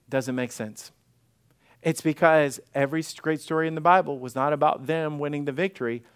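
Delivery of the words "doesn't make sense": soft, low "doesn't make sense" as a closing reminder; quiet refrain. Shown as a demonstration. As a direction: drop the voice and let it land. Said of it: "just remember".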